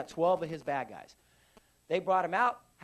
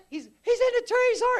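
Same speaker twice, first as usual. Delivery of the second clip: very high, loud frantic yell; imitated high-pitched soldier in alarm; panicked yelling; 10 dB louder than usual. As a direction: yell the warning up to your sergeant. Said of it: "Floyd starts yelling".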